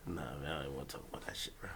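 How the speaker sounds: rushed embarrassed whisper; fast whisper. Real, not acted. Brushing off the Weinstein joke in a fast whisper. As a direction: hurry it out under your breath.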